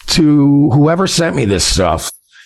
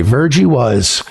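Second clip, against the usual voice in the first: ordinary delivery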